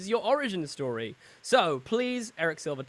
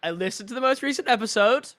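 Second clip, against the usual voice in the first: imitating a proud family member; 7 semitones higher, 6 dB louder than usual. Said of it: "family members being like".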